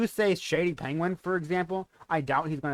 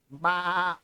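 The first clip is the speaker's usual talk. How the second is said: put-on sheep's baa; sheep bleat; 3 semitones higher than usual. Shown as a demonstration. Streamer demos animal noises for donors, bleating. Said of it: "bark, baa".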